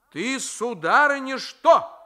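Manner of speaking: high, loud scolding cry; angry shout. A narrator's reading. Grandmother shouting at Marfenka for mocking her, angrily. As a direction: bark at the cheeky girl. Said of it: "крикнула бабушка сердито".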